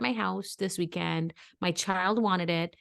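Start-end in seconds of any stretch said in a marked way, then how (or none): none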